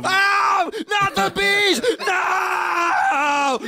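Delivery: high, loud hammed-up Cage scream; hammy stage actor. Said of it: "Wow, dude, you got chops".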